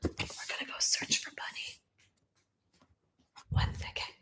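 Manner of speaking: soft unvoiced whisper so nobody wakes. Real, not acted. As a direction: hush it, everyone is asleep. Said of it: "I'm whispering 'cause everyone's asleep".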